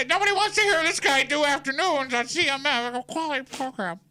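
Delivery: high, hammed-up corporate voice; mock a stiff corporate boss; corporate guy voice. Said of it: "has that voice".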